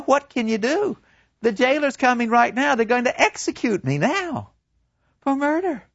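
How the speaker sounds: put-on high, distraught girl pleading; a broken young woman begging her lover; Gretchen's high voice. To her lover, Gretchen gone mad with grief, high and pleading.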